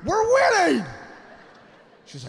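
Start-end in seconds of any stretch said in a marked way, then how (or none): 0.0-0.8 high, elated holler; excited calling out. Acted. Husband calling wife to the table, thrilled shout. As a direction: holler it like a happy drunk winner.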